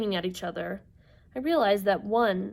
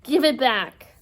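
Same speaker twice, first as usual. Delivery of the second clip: louder, raised demand; crying out; 4 semitones higher, 8 dB louder, slower than usual. Acted. Inger to Percy who holds her eye, crying out. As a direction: call for it back firmly. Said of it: "Inger cried".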